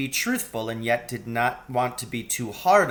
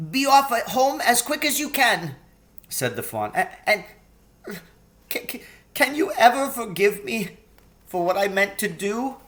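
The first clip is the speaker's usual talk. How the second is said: high, anxious faun voice; a fretful, sorrowful faun; 10 semitones higher than usual. A narrator's reading. Tumnus asking forgiveness.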